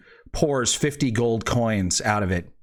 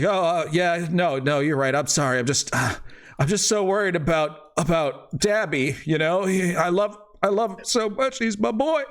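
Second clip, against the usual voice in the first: high, meek pleading; cowed father; 5 semitones higher than usual. Acted.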